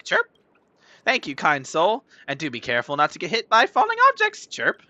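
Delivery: high, loud chirpy birdie. Acted. Streamer voicing a stuck bird thanking the player, bird voice. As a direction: read it as a chirping little bird character. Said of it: "You like my bird voice?".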